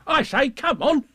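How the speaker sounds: high, loud bouncy shout; Tigger's boisterous call. Acted. Tigger hails friends in the mist, high boisterous call.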